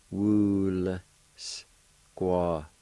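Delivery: "Wool squat" in long, separate sounds; syllable-by-syllable pronunciation. Shown as a demonstration. Teacher showing the L sound with W U, sounded out. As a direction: draw out "Wool", pause, then "squat". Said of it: "pronounce the L sound with the W U like this".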